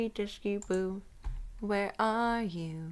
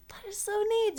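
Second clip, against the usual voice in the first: gushing; 13 semitones higher than usual. Real, not acted. Gushing over item.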